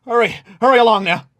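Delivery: lightly voiced innkeeper; hurried innkeeper. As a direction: hurry them inside. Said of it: "turns to you and says".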